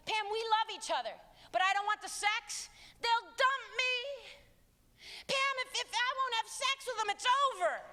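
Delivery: put-on high, pleading teen voice; lightly voiced anxious teen; whiny teen. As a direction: mimic a whining teenager.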